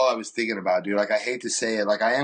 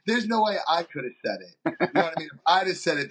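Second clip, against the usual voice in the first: usual speaking voice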